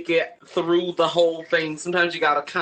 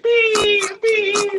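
high-pitched electronic beeps; imitated beeping; 4 dB louder than usual. Mimicking health officials arriving, high alarm beeps. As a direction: beep like an alarm, high and even. Imitating reversing hazmat truck.

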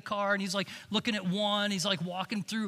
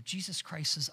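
ordinary delivery

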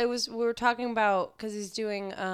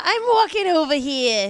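put-on New York accent; 7 semitones higher, 11 dB louder than usual.

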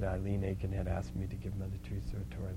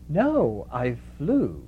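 lightly voiced comic comeback, higher and louder; Mother Teresa; 6 semitones higher, 6 dB louder, much slower than usual. Mother Teresa jokes back to Lena, mock-playful retort. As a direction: play along drily with a raised voice, laughing after. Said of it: "only time I ever saw her get funny".

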